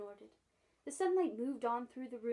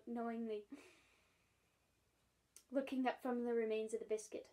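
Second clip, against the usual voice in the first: usual speaking voice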